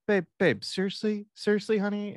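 high, pleading exasperated wife voice; a wife begging her husband to stop, high and pleading; mock exasperated wife. The wife in the car pleads with her raging husband, high and exasperated.

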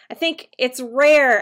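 usual speaking voice

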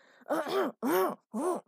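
loud pointed throat clear; an exaggerated ahem to interrupt. A narrator's reading. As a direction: clear the throat loudly to cut her off. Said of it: "clearing his throat loudly".

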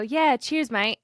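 put-on "cheers, mate" with the visitor's British accent. She answers the camper in kind, put-on British accent. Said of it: "thinks I said it in the accent".

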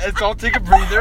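ordinary delivery

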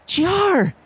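very high squealing crowd cry; screaming fan. Acted. Fan calls to G R from the crowd, shrieking high. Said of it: "you hear something from the crowd going".

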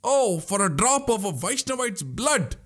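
high, thin mock shriek of a bloodthirsty dagger. Vandiyathevan voices his thirsty dagger to Nambi, high mock shriek. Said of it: "it shrieks".

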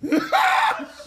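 high, loud burst of laughter. Real, not acted. Laughing at his own story, high and loud. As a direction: explode into a laugh.

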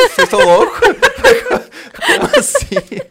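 high, loud laughing disbelief. Real, not acted. Laughing at the absurd rescue idea.

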